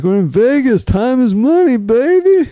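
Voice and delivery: hyped catchphrase. Retold or imitated. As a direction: sell the line like a hype man, climbing up. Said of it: "And I'm like".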